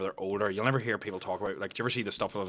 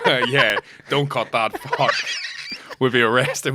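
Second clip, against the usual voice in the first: laughing; 4 semitones higher, 6 dB louder, slower than usual. Real, not acted. Laughing at own joke.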